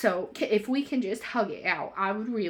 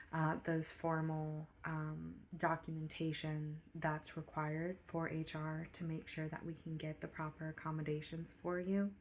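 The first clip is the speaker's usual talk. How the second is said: quiet private talk; 7 dB quieter than usual. Acted. Manager's quiet private meeting.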